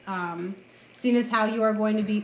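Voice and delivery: usual speaking voice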